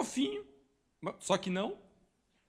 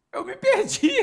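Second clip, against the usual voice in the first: put-on child voice; 9 semitones higher, 7 dB louder than usual. Boy answering his aunt.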